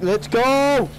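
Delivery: high, drawn-out rallying cry; excited shout. Real, not acted. Player rallying the squad, excited shout. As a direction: yell it to pump up the team.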